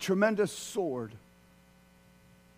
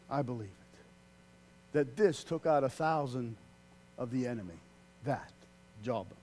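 hushed; 8 semitones lower, 6 dB quieter than usual. Real, not acted. Hushed declaration of faith.

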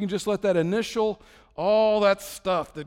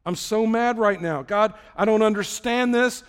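louder, higher angry prayer; acted frustration; 4 semitones higher, 5 dB louder than usual. Believer venting to God, acted angry protest. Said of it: "It's okay to say".

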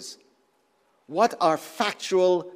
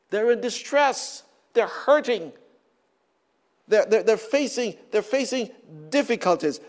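higher, louder preaching push; impassioned preaching; 5 semitones higher, 4 dB louder than usual. Acted. Preacher stirring the congregation about people in distress, impassioned. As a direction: drive each word of pain home.

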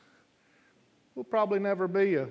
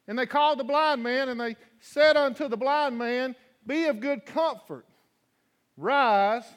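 higher, louder preacher voice; fervent preaching; 6 semitones higher, 4 dB louder than usual. Acted. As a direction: preach it with rising fire. Preaching the scripture reading.

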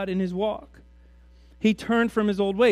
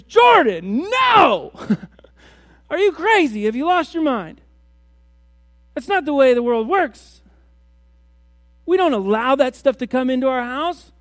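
much higher and louder, outraged shout of "no"; wife's outraged shout; 5 semitones higher, 8 dB louder than usual. Acted. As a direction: yell at your husband like he's lost his mind. Wife scolding husband's new wives.

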